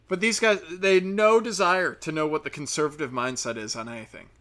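ordinary talk, nothing marked

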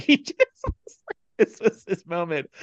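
ordinary talk

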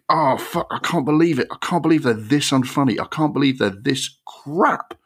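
high, incredulous groaning disbelief; exasperated disbelief. Retold or imitated. Host reliving his cringing thoughts watching the film, high exasperated disbelief. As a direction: replay your horrified thoughts, pitch up high.

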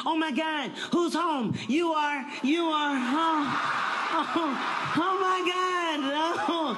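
high, drawn-out gushing welcome; overly happy wife. Retold or imitated. Wife greeting husband at the door, high gushing. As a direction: gush over him like a giddy wife. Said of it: "she happy".

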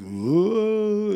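high ghostly woo, held back; imitated ghost woo. Echoing the ghost sound again, high ghostly woo. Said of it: "that's exactly what it sounded like".

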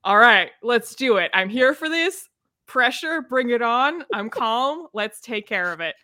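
rally yourself for the big challenge, then settle; psyched-up readiness. Acted. Acting eager readiness for big pressure.

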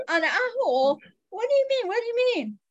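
high-pitched, puzzled questioning; baffled retold reaction. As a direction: act out being thrown, pitch leaping up. Retells own baffled reaction.

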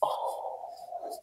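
breathy whisper of pain. Real, not acted. Telling host about the breakup, whispered. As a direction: whisper the hurt.

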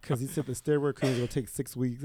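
normal talking voice

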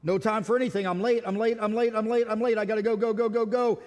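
hurried, frantic repetition; mock a person racing past everyone, late; rushed. Preacher acting out a harried latecomer, rushed. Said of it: "Are you rushed?".

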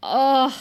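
long heartsick "ugh"; pained groan. Real, not acted. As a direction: groan in real anguish at the scene. Groans at painful scene.

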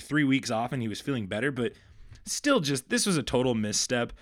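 normal talking voice

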